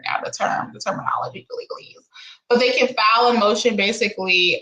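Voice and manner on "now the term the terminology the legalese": whispered, searching for the word; whispering to herself. Real, not acted. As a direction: whisper it while trying to remember. Groping for a word.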